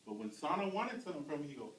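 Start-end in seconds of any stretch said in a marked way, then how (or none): none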